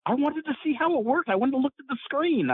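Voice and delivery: high, eager retelling of the other man's words; Jack Armstrong. Retold or imitated. Retelling how the wrestler explained watching his own colonoscopy, high and eager. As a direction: bounce it up like a keen kid. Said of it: "He says".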